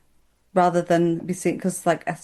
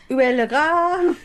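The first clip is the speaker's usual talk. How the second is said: mock French tourist; 8 semitones higher, slower than usual. Pretending to be a lost tourist.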